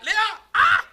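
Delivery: a startled yelp of discovery; shocked cry. Acted. Yaakov to his bride, discovering Leah: shocked shriek.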